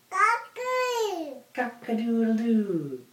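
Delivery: high, slow crowing rooster call; drawn-out high cock-a-doodle-doo. Acted. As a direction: crow it out like a rooster. Rooster sound for the baby.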